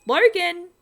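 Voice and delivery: high, loud panicked shout; yelling down a dead phone line; shouting a name. A narrator's reading.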